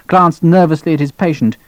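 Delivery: plain reading voice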